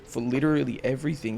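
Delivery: ordinary talk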